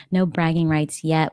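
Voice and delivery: normal talking voice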